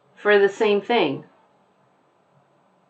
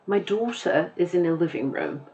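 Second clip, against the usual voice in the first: softer British take, daughter without final r; British accent; 5 dB quieter than usual. Shown as a demonstration. Teacher compares musicality of two accents, British accent. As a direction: put on a light British accent. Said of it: "the way that I pronounced the words was different".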